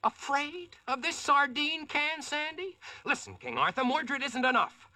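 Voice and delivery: brash, scornful challenge; cocky defiance. Acted. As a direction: scoff at the knights. Hero boasts defiantly at the king.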